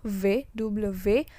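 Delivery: "V, double V" slowly, as "vé, doublə vé"; French hard V. Shown as a demonstration. Teacher reminding students to press V, slow firm sound. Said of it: "Ingat, ditekan tebal".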